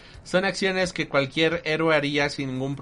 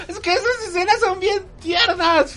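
gushing high; 13 semitones higher, 5 dB louder than usual. Real, not acted. Gushing about tender scenes.

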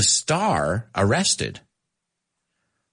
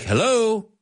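wife calling out; 11 semitones higher than usual. Retold or imitated. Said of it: "And she's like".

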